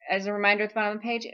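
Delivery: usual speaking voice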